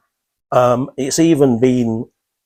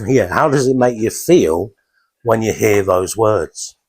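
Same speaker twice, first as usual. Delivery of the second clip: ordinary delivery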